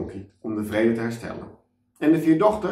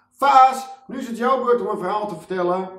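high, loud call across to a friend; girl calling loudly from her window; 10 semitones higher, 4 dB louder than usual. Acted. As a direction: shout it up from the window. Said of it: "roept Charlie vanuit haar raam".

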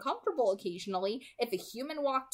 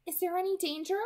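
put-on light girl voice; 9 semitones higher, faster than usual. A narrator's reading. Alice voiced, higher female voice.

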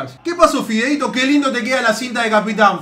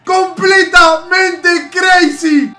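excited shout; 5 semitones higher, 5 dB louder, much slower than usual. Real, not acted. Goal celebration shout.

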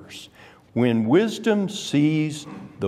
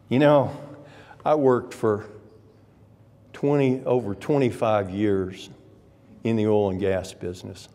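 ordinary talk, nothing marked

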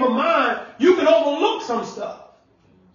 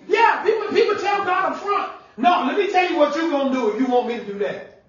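preaching at full force; 5 semitones higher than usual. Acted. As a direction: call it out to the congregation. Preacher's high shouted rebuke.

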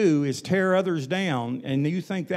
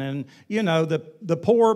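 normal talking voice

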